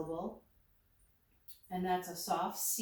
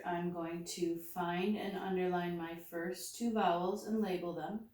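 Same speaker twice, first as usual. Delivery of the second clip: ordinary delivery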